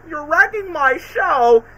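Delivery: high, slow outraged cartoon whine; Deadpool. Deadpool whining at Spider-Man wrecking his cameras, high outrage. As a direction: squeal it like a wounded showman. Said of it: "Deadpool's like".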